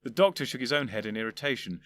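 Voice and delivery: ordinary delivery, nothing marked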